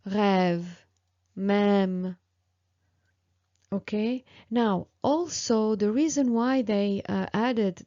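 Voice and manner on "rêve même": "rêve, même" with a lengthened open ê; prolonged vowel. Shown as a demonstration. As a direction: hold the ê a little in each word, "rêve", then "même". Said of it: "you prolong a little bit more the sound".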